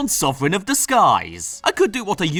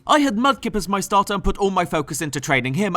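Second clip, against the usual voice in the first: ordinary talk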